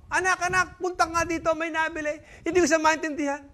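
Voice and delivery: high, calling out to son; father calling. Acted. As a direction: summon the kid urgently. Father calls his son.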